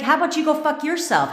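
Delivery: high, loud snapped retort; mock comeback. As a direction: fire it back at the bestie. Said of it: "You'd be like".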